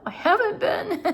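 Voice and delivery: sheepish laugh after admitting a feeling. Real, not acted. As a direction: laugh it off, embarrassed. Laughs at her own confession.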